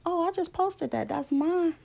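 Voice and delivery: high-pitched, eager retold realtor; imitating the realtor. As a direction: pitch it up high like the proud listing agent.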